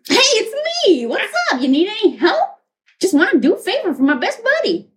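high, eager helper voice; Doctor Fate. Acted. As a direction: pop in like a chirpy best buddy. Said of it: "Doctor Fate appears".

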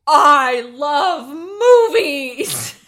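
loud, very slow drawn-out exclamation; Keanu Reeves. Acted. Co-host opens as award-show guest, drawn-out loud impression. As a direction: belt it out, stretching each word. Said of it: "I'm Keanu Reeves at an award show".

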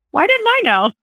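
high, far-off bewildered cry; mock bewilderment. Host mocking own surprise, high bewildered cry. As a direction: play up the baffled self.

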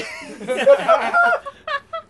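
high squealing laugh; embarrassed shrieking laugh. Real, not acted. Embarrassed by the fan art.

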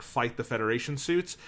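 usual speaking voice

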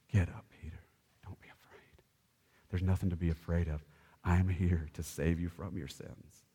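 low, soft and gentle reassurance; tender Jesus. Acted. Jesus reassuring fallen Peter, low gentle voice. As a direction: speak softly to a frightened friend on the ground. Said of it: "Jesus touches them on the shoulder and says".

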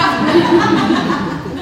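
a light airy laugh at herself; breathy laugh. Real, not acted. Laughing at her own example of unexplained feelings, airy laugh.

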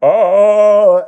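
high, loud sustained "ah"; soaring full-voiced scream into a mic; rock scream. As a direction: belt one long screaming note.

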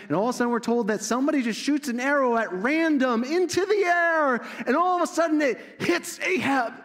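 high, loud, building excitement; climactic storytelling. A narrator's reading.

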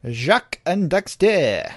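slow, hammed-up French accent; terrible French accent. Naming the next game, mock French accent. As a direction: announce it in a bad French accent. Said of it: "It's a terrible French accent".

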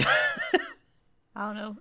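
high, drawn-out laughing words. Real, not acted. Laughing through uncertain answer.

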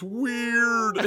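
put-on toddler voice. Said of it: "she goes".

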